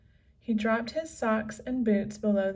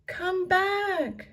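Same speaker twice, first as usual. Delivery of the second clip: high raised call to come back; shouted; 10 semitones higher, 4 dB louder than usual. A narrator's reading. Annie calls Jack back from the water, high shout. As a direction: yell after him. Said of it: "Annie shouted".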